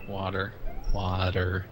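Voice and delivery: usual speaking voice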